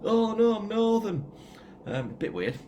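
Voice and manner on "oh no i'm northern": high, loud northern English wail; pitched-up, hammed northern English voice; mock northern accent. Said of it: "all of a sudden I've gone northern".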